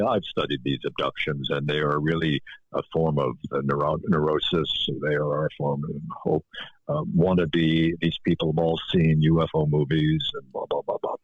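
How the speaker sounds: lightly hammed-up dismissive expert; mock expert. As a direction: talk down like a smug TV physicist.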